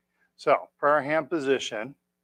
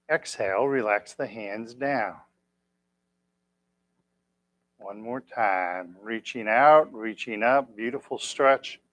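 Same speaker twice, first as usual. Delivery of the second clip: gentle guided stretch; slower than usual. Acted. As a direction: ease the class into the stretch slowly.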